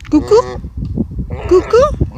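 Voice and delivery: high cuckoo call. Real, not acted. Calling to the goose.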